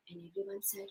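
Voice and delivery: ordinary delivery, nothing marked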